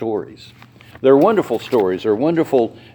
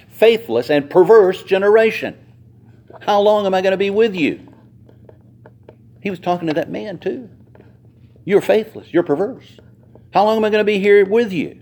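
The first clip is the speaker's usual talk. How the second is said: exasperated rebuke; 7 semitones higher, 4 dB louder than usual. Retold or imitated. Jesus rebukes the crowd.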